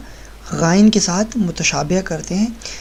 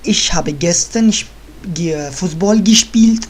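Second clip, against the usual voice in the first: slow model sentence; 3 dB louder, much slower than usual. Shown as a demonstration. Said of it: "teacher models German sentence".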